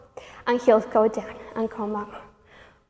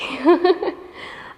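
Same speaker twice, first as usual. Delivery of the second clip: short laugh after the workout; breathless laugh; 5 semitones higher than usual. Real, not acted. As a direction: laugh it off, a bit winded. Laughs at her sweating.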